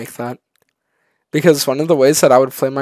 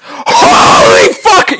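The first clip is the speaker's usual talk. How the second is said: loud, slow, higher excited yell; excited shout; 9 dB louder, much slower than usual. Real, not acted. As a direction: yell it out in delight. Excited reaction to the voice.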